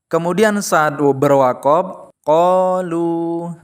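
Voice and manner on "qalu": qalu, very slow, the final lu held for two beats; qalu with long lu. Shown as a demonstration. Teacher modelling the correct long qalu, very slowly. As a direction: draw out the lu of qalu. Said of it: "tetap dibaca panjang".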